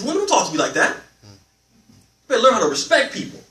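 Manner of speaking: hammed-up angry husband telling her off; scolding husband. Husband snaps back at his frustrated wife: mock angry scolding.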